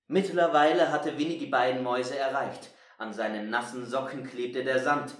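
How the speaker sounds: plain reading voice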